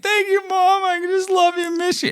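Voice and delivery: high, hammed-up teary voice; mock crying. Joking he didn't cry to mom during breath work, mock weepy and high. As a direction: pitch way up like fake sobbing thanks. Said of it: "I wasn't, like, crying".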